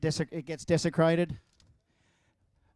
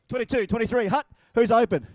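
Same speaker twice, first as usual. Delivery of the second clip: calling the snap count a bit louder, playing quarterback; 5 semitones higher, 6 dB louder than usual. Pretending to be the quarterback for the crowd, calling the snap count. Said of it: "just pretend I'm the quarterback".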